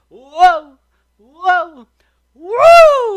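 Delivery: high, loud whoa on each swing; swinging whoops. A narrator's reading. Brer Rabbit swung round by the ears, whooping loudly. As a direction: whoop as if whirled through the air.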